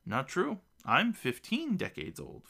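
high, measured assurance; confident statement. A narrator's reading. Old gnome corrects the girls on his age, confident. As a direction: state it proudly, stretching it out slowly. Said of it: "Fedar states with confidence".

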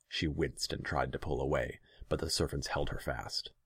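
usual speaking voice

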